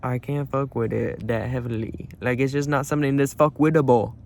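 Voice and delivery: ordinary delivery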